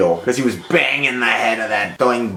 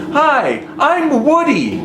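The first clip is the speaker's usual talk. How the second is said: much higher, slow, sing-song creepy man; creepy guy's greeting; 14 semitones higher, 3 dB louder, slower than usual. Retold or imitated. Imitating the creepy man in church greeting the sisters, high and drawn out. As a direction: mimic the weird man cheerfully introducing himself. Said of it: "he looked at us and he said".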